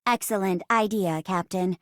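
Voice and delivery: even, measured robot computer voice; robot assistant. Acted. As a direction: report like a ship's computer, evenly paced.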